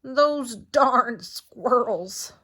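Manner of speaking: put-on slow, drawn-out grumbling old man shout; grumpy old man yelling. A narrator's reading. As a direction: growl it out like a cranky old man. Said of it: "he shook his fist and yelled".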